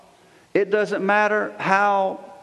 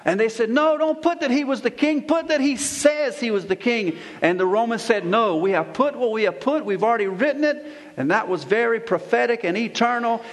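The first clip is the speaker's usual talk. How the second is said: forceful preaching; 4 semitones higher than usual. Real, not acted. Pastor proclaiming Christ as king to his congregation, forceful preaching.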